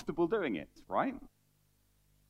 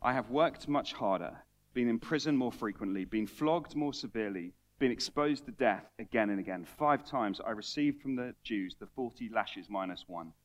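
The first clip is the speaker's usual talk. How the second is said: scripture reading; 7 semitones lower than usual. Acted. Reads scripture passage.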